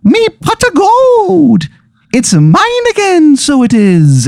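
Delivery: high, slow gleeful Irish leprechaun. Acted. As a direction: gloat like a leprechaun, slowly. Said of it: "Izzy Irish goes".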